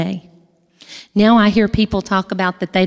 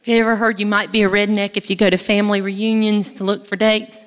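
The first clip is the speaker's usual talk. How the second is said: ordinary delivery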